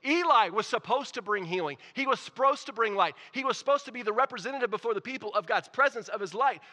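loud, high preaching at full force; impassioned preaching. Real, not acted. Preacher to church on Eli's failed calling, impassioned. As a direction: drive each phrase home, high and loud.

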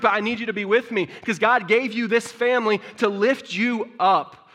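loud, rising preacher's cry, building to a peak; preaching at full force. Acted. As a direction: preach it hard to the church.

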